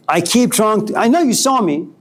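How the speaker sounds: usual speaking voice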